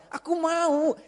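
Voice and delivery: put-on high, drawn-out kid's whine; imitation of a pleading little kid; whining child. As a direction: insist like a sulky child. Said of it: "anak kecil itu ya".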